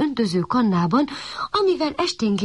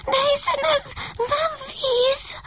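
very high, slow whine; whimpering puppy. A narrator's reading. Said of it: "vinnyogott a kiskutya". (magas, vinnyogó, ijedt hang)

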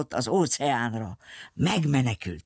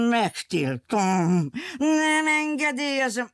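angry forbidding villain; 8 semitones higher than usual. A narrator's reading. Rombilli lands and bans the ship circus, high angry holler. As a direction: forbid it in a sustained high yell.

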